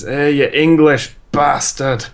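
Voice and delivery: slow, hammed-up Scottish accent yell; mock Scottish accent. S0 joking about what Scots say, hammed-up Scottish accent. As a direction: play a cartoon angry Scotsman, Scottish accent. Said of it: "all I know how to say in Scottish is".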